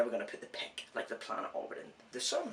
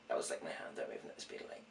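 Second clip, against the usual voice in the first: whispering; 10 semitones lower, 10 dB quieter, faster than usual. Real, not acted. Quick whispered aside.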